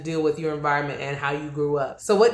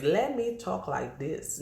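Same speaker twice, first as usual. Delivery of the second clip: slow, softer put-on Nigerian accent; mock Nigerian accent; 8 dB quieter, slower than usual. Host mocks switching on purpose around Nigerians, mock Nigerian accent. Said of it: "I'm around Nigerians".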